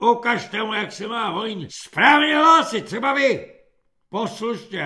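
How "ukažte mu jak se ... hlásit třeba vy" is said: loud, high drill command; bellowed order. A narrator's reading. Corporal demanding a proper report, bellowed high. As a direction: bellow it at the next recruit. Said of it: "zahulákal svobodník".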